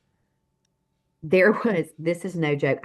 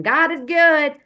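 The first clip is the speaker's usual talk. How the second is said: high, loud praise-the-Lord cheer; hammed-up joyful proclamation; mock exuberant praise; 10 semitones higher, 8 dB louder, slower than usual. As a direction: imitate someone crowing in triumph. Mimicking people's selective, cheerful praise.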